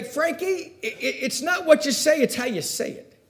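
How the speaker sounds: high-pitched little grandmother voice. Retold or imitated. Italian grandmother advises her grandson, in a high imitated voice. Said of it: "she would say".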